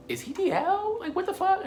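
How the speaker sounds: high-pitched squeal of disbelief; shocked high squeak. Retold or imitated. Retelling own shocked reaction.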